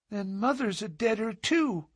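put-on high child voice on the edge of tears; a small girl realizing her mother is dead; crying child. A narrator's reading. Little girl learns her mother died, high and tearful. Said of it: "cried the little girl".

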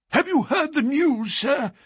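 high-pitched, agitated butler; gasped. A narrator's reading. Butler rushing in at breakfast, gasping the news. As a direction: gasp out shocking news. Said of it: "he gasped".

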